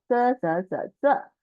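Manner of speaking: 这 said zhè, sharp falling tone; falling fourth tone. Shown as a demonstration. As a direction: drop hard on 这. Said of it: "For tone".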